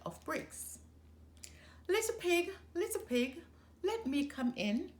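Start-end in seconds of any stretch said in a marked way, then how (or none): 1.9-4.9 high, sing-song wolf call; sly wolf coaxing at the door; wolf calling sweetly. A narrator's reading. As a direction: coax the pig to open up in a high lilting voice. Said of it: "said the wolf".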